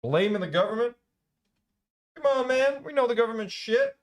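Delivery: high, loud exasperated comeback; indignant retort. Real, not acted. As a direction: scoff at his excuse. Arguing back at the neighbour.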